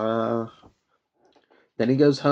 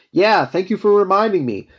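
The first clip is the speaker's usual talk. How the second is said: higher, louder Deadpool retort; playful, raised-voice quote; eager acted reply; 7 semitones higher, 7 dB louder than usual. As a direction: jump in brightly as if just reminded.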